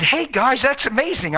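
high, upbeat mock greeting; enthusiastic greeting. Preacher greeting a couple he passes, enthusiastic. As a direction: hail them with bright, high-pitched amazement.

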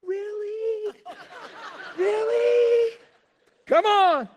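high, loud thrilled cries; excited wife. Retold or imitated. Wife thrilled that husband finally agrees, high loud cries. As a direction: burst out with disbelieving joy. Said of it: "my poor wife is going".

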